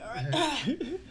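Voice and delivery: mock sick all right with a laugh. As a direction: fake sick voice cracking into a laugh. Fake sick act to mom.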